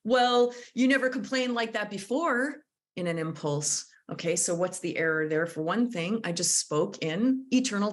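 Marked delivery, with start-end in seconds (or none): 0.1-2.6 accusing. Retold or imitated. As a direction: snap it out as an accusation. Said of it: "Maybe you said".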